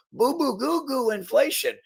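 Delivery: very high, babyish mock whining; mocking whine. Mocking people who complain about inflation, high babyish whine. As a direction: squeak it like a whiny baby.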